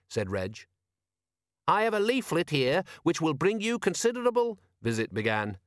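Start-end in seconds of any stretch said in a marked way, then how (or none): 1.7-4.6 high-pitched, preachy zealot voice; pious constable. Acted. Constable Visit quoting scripture to shipmates, high pious voice.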